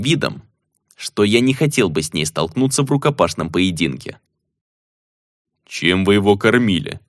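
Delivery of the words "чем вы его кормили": usual speaking voice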